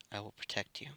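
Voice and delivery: very soft, hushed promise; quiet reassurance to someone sleeping; softly protective. Acted. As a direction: say it low in volume, as a tender vow. Protective words over sleeping child.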